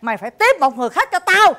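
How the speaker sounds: loud, high-pitched harsh order; forceful and heated; angry demand. Acted. Harsh order in acted scene.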